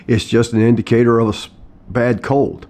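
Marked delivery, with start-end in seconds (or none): none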